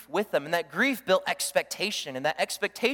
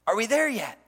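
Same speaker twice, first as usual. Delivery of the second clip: put-on impatient kid; 6 dB louder than usual. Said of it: "sound like the kindergartner on the road trip".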